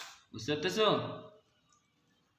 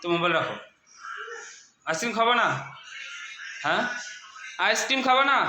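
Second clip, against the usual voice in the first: calling out; 9 semitones higher than usual. Real, not acted. Mother calling child off phone.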